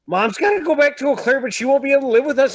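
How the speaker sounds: put-on high, fast scared kid voice; kids panicking. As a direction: voice the kids' panic, quick and high. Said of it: "they're like".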